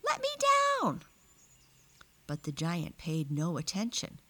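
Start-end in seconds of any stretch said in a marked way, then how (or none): 0.0-1.0 high screamed demand; captured girl's high, urgent cry. A narrator's reading. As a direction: scream to be released. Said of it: "screamed the girl".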